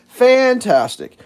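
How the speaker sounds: emphatic sarcasm. Retold or imitated. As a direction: stretch it out with sarcastic relish. Louder sarcastic repeat.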